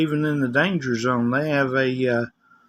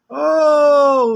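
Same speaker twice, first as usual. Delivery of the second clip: long, high, loud "oh"; gushing women callers; 13 semitones higher, 8 dB louder than usual. Retold or imitated. Women callers reacting to his accent, high gushing mimicry. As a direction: imitate women cooing in delighted surprise. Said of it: "especially the women".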